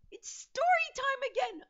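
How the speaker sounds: high, loud put-on story-time call; high announcement. Host hailing viewers for story time, high playful announcement.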